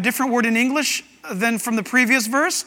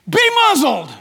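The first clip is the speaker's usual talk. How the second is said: shouted command; 7 semitones higher, 6 dB louder than usual. Acted. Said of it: "Jesus shouted".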